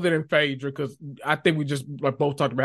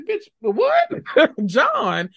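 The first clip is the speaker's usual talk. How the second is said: Janelle's reaction; 9 semitones higher than usual. Retold or imitated. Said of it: "Janelle was like".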